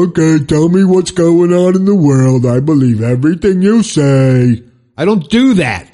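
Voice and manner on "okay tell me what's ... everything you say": louder, dazed voice of a mindless TV watcher; mock brainwashed viewer. Mocking people who believe the TV, hollow brainwashed voice. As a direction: play a zombie soaking up the news. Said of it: "open up my brain and go".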